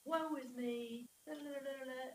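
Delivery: singsong poor-me complaint; mock lament. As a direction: wail it lightly, stretching the words. Mimics the psalmist's self-pity.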